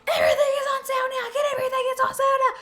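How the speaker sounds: very high, fast, shouted ad voice; shouty sale ad. Retold or imitated. Parodying a frantic TV sale ad, very high and shouted. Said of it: "those ads that it's like".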